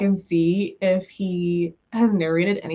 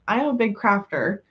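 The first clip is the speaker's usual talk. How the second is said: usual speaking voice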